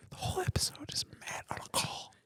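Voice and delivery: squeaky breaks in a whisper; hushed incredulous aside. Real, not acted. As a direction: whisper while barely holding it together. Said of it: "We're whispering".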